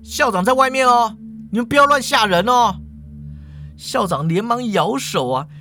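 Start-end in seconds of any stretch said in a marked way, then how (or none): 0.0-2.8 high, raised call up to a window; higher-pitched storytelling yell. A narrator's reading. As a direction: call out to whoever is inside. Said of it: "就对着那扇窗口大叫了".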